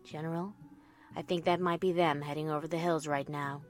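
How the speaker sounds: low, hushed, level report; quiet captain. Acted. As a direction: report softly to the general. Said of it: "my quiet captain told me".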